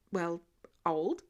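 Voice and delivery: ordinary delivery, nothing marked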